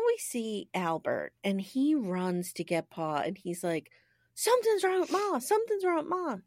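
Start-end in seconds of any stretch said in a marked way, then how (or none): 4.3-6.4 lightly acted high-pitched boy's alarm; mock alarm. Albert running to get Pa, mildly alarmed.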